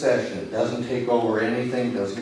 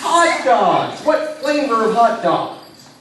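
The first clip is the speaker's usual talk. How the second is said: much higher and louder, delighted; showman's delight; excited; 10 semitones higher, 7 dB louder, slower than usual. Acted. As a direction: play up the surprise for the audience. Hypnotist reacts on stage.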